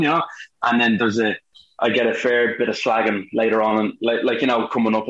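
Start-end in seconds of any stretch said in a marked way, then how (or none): none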